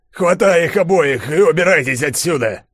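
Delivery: urgent command. A narrator's reading. Gromov sends Yulia away with both, urgent command. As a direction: order them out at once.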